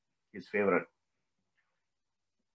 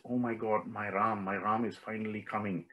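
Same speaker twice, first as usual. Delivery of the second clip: hushed relief; 2 semitones lower, 11 dB quieter than usual. Retold or imitated. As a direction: voice a tearful woman's relief very quietly. Overjoyed Sita softly voiced, in tears.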